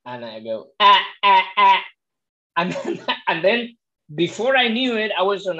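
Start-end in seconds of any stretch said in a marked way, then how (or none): 0.8-1.8 loud staccato "ah"s, pitched up; glottal onset "ah". Retold or imitated. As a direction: copy the exercise with hard-attacked "ah"s. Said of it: "with your glottal onset".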